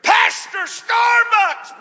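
a happy yell pulled out slowly; joyful shout across the room. Retold or imitated. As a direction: call his name across a crowded coffee shop. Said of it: "and I hear".

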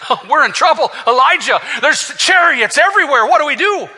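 panicked servant. Acted. Servant runs in to warn Elijah of chariots, panicked. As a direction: cry out the alarm, climbing in pitch.

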